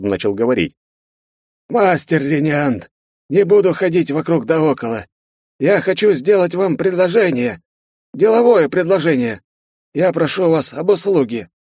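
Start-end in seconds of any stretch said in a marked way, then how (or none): 1.7-2.9 high, drawn-out polite address; a genial rector choosing words; slow courteous rector. A narrator's reading. Rector begins, slowly. 3.3-11.5 high, courteous official voice; a smiling rector making an offer; genial rector. A narrator's reading.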